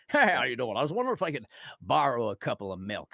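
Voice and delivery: lightly voiced ordinary guy, a bit fast; casual alien neighbor. A strange alien borrowing milk in a casual voice. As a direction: play the alien as a normal neighbor. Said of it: "strangest alien in the world, and he's like".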